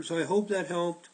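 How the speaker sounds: ordinary talk, nothing marked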